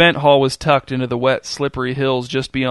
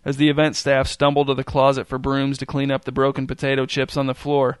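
usual speaking voice

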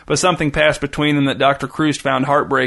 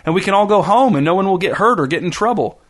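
trying not to plead; 5 semitones higher than usual. A narrator's reading. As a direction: sound reasonable while barely hiding a plea. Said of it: "He did his best not to sound as if he was pleading".

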